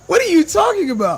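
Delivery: loud, high-pitched disbelief; incredulous. Real, not acted. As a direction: throw it out high and loud, utterly baffled. Laughing in disbelief at the critic.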